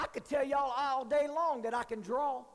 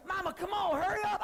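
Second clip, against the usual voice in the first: higher pushy hurry-up, rising as it goes; mock impatient plea; 8 semitones higher, 3 dB louder than usual. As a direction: nag mama to hurry, pitch climbing. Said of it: "I've heard you tell your".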